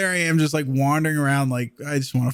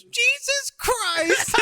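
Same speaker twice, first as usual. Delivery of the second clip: put-on very high, stretched-out child voice; copycat kid; much slower than usual. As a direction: mimic a little kid parroting dad, squeaky and slow. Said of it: "then his kid just goes".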